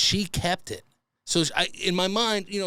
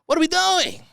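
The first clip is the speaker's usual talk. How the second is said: exasperated outburst; 11 semitones higher, 7 dB louder than usual. Real, not acted. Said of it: "exasperated rant peak".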